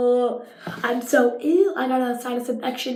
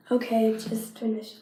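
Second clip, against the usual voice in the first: usual speaking voice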